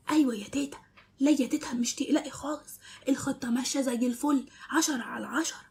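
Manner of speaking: hushed secret phone call; low voice. Acted. Zein whispering to his grandma on the phone about the plan. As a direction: keep it low so nobody hears. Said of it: "بصوت واطي".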